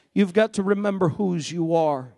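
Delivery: ordinary talk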